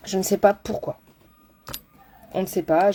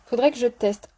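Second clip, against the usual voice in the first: normal talking voice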